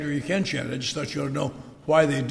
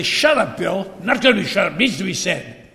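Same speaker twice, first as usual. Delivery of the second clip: loud, higher, hammed-up old fool shouting back; indignant outburst; 4 semitones higher, 7 dB louder than usual. Bill Davidson shouting down the meeting, loud and higher. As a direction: bellow it like a stubborn old zealot refusing to be hushed. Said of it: "he would say this and say".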